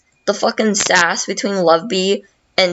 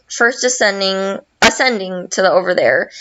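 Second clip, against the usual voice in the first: usual speaking voice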